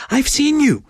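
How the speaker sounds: high, excited exclamation; high eager exclamation. Acted. Continues excited shriek.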